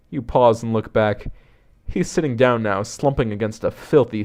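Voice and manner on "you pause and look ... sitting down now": ordinary delivery, nothing marked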